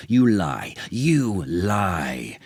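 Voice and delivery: loud angry charge, then held and grinding; furious accusation. A narrator's reading. Jealous king accusing his adviser, furious. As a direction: hurl it at him in rage. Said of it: "beside himself with anger and jealousy".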